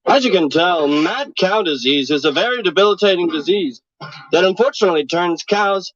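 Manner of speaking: deadpan mock TV reporter; news reporter. Reporter explaining mad cow disease to viewers, deadpan news read. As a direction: wrap it up like field news.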